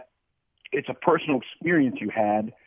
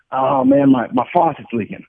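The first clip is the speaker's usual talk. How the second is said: high, loud mock lament, easing down; mildly hammed-up exasperated groan of words; acted complaint; 10 semitones higher, 7 dB louder than usual. Man complaining to his buddy at lunch, mock exasperation.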